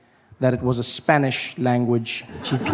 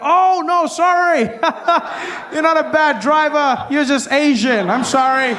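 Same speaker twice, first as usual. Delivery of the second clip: mock apology; 12 semitones higher than usual. Pulling up beside the driver, a high mock-sweet apology. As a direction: gush a sarcastic sorry. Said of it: "I pull up next to them, I'm like".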